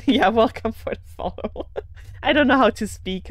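real giggles breaking into the words; laughing at own slip. Real, not acted. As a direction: laugh while mocking your own wording.

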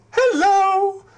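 very high tiny voice; squeaky flower voice. Acted. The flowers answer.

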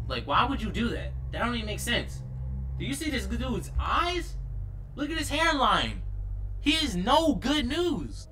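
high, loud freaked-out rant; excited yelling. Real, not acted. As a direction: yell it in disbelief. Panicked rant at the game.

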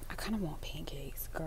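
soft whispered craving; whispering. Real, not acted. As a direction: whisper it like a secret. Confiding a craving.

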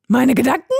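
höher und lauter, erschrocken; Stimme überschlägt sich. A narrator's reading. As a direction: hell aufschrecken. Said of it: "Heidis Stimme überschlug sich". (Heidi erschrickt, die Stimme kippt nach oben)

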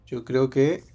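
usual speaking voice

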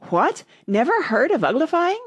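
high, loud, astonished Gryphon; surprised exclamation. A narrator's reading. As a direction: exclaim in sheer disbelief. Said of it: "lifted up both its paws in surprise".

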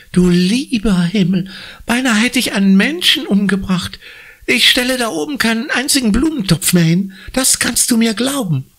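high, agitated woman, rushing the vow; horrified at what nearly happened; aghast woman. Acted. Woman swears to Conny, aghast and fast.

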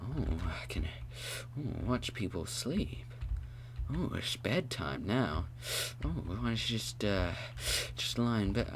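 soft so as not to wake sleepers; tiptoe-soft voice; hushed bedtime voice. Real, not acted. People asleep, bedtime.

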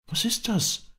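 very high-pitched amazed princess; astonished cry. Acted. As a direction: exclaim in wonder, pitch way up. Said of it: "rief die Prinzessin". (staunender, hoher Ausruf der Prinzessin)

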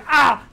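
loud, high mock scream; hammed-up meme scream; imitated eagle scream. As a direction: belt out a parody eagle scream. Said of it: "the eagle ... goes".

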